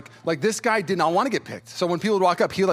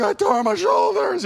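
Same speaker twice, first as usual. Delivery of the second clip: much higher, slow, pained whine; 13 semitones higher, 5 dB louder, slower than usual. Retold or imitated. Telling his friend at the gym, high pained whine. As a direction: whimper it out, hurting.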